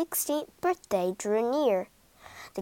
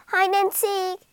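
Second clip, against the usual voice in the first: shouted; 8 semitones higher than usual. Acted. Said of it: "she shouted".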